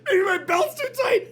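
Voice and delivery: can barely get it out for laughing. Retold or imitated. Tells Maggie the doctor's diagnosis, laughing.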